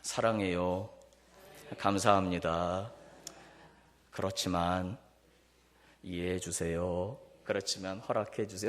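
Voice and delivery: practice asking permission lovingly; gentle respectful. Shown as a demonstration. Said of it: "한번 따라서 한번 연습".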